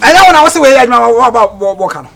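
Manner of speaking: loud declaiming. Shown as a demonstration. Coach models the line for a trainee, loud declaiming. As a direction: fire the whole line out at full volume.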